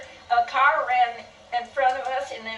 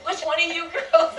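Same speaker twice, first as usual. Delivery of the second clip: laughing through a quote; 7 semitones higher than usual. Retold or imitated. Dad calling out to girls.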